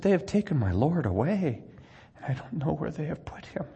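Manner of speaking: high, hushed voice sinking into a whisper; Mary's broken answer, fading to a breath; grieving Mary. Acted. Mary answers the angels in a high, whispered grief. Mary weeping at the empty tomb.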